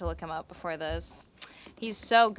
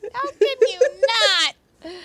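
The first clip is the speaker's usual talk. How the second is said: very high, loud, drawn-out shout; mock outraged yell; 14 semitones higher, 12 dB louder, slower than usual. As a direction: escalate into a comic yell. Repeats the line much louder.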